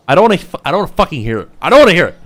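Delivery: high, loud protest, cutting off and restarting; angry refusal. Real, not acted. Streamer refusing chat's comments, angry refusal. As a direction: shout them down in exasperation.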